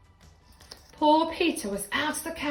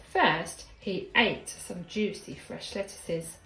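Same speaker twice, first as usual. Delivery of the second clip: usual speaking voice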